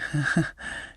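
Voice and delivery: short, breathless, embarrassed laugh; sheepish laugh. Acted. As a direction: laugh it off like a kid caught out. Izuku caught after messing kitchen.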